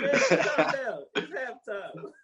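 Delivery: laughing calm down. Retold or imitated. Retelling the movie to a friend, laughing slow quote. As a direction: say it through laughter. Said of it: "He said".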